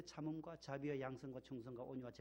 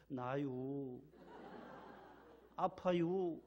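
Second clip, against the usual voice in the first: easygoing Chungcheong complaint with a laugh; a mild Chungcheong grumble, no anger at all; Chungcheong drawl; 3 semitones lower, much slower than usual. Retold or imitated. Chungcheong man with arm twisted, calmly complaining in dialect. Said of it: "충청도 분들 팔을 삐틀어도".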